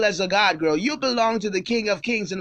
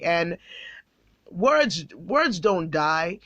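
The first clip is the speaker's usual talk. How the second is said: usual speaking voice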